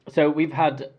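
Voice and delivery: ordinary talk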